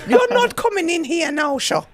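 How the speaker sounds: high, loud scolding Irish mother at the door; mock Irish mammy. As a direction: nag like an Irish mammy waiting up.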